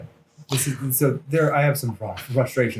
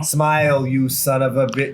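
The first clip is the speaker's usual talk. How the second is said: louder New York tough-guy tag line; hammed-up New York accent, louder; 2 semitones higher, 4 dB louder than usual. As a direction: snarl it like a brash New Yorker. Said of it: "the way he talked".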